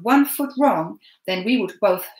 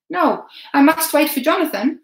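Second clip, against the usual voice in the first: put-on higher, louder stubborn boy; boy refusing; 8 semitones higher, 7 dB louder than usual. A narrator's reading. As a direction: refuse and hold firm. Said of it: "I said".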